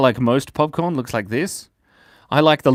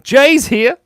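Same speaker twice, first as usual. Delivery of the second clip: excited greeting; 13 semitones higher, 8 dB louder than usual. Real, not acted. Greeting a new chat viewer.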